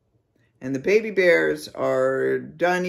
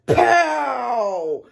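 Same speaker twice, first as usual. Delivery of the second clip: long, loud, high pow; imitated gunshot; 7 semitones higher, 5 dB louder than usual. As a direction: boom it out like a shot.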